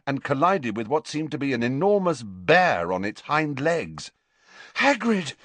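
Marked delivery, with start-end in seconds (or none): 4.8-5.3 panted. A narrator's reading. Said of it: "he panted".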